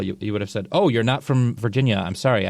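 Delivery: ordinary delivery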